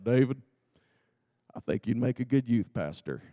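low-voiced impression of a big Texan; Kyle. Texan friend suggesting youth ministry, low imitation. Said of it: "that's the way he talked".

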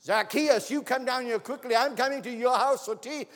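high, loud summons; Jesus calling loudly up the sycamore tree. Acted. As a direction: call him down with joyful command. Jesus calls Zacchaeus again.

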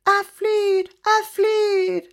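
high, loud joyful cry; joyful shout. Acted. Elle cries out riding on the crow, joyful shout. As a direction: shout with delight while flying. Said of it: "Elle roper".

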